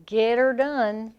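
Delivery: slow Southern "git-er-done"; drawn-out Southern catchphrase with heavy R; Southern accent. Shown as a demonstration. Host showing off the South's R, slow Southern accent. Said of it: "we can add the R".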